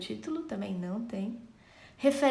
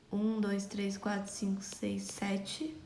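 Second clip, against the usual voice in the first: soft counting; 7 dB quieter, slower than usual. Shown as a demonstration. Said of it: "Só comprovando aqui".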